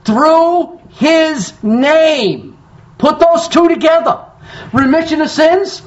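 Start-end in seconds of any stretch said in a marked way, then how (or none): 0.1-2.6 "Through his name" stretched very slowly, each word weighted; slow emphasis. Shown as a demonstration. Preacher showing the church the key words, "Through his name" slowly weighted. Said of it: "three words I want you to see".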